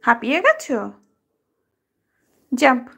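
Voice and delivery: ordinary delivery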